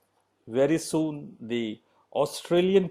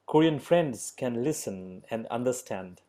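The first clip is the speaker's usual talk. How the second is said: ordinary delivery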